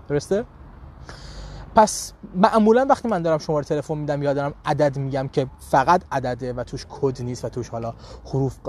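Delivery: ordinary delivery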